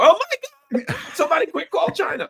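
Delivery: high, fast, laughing alarm; mock panic. Host mocking politicians' panic in a high, fast, laughing voice. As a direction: feign frantic alarm.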